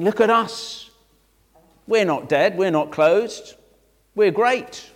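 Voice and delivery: high, emphatic mock bragging; hammed-up self-promotion; boastful. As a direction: crow about yourselves in punchy bursts. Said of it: "talking by the standards of the world".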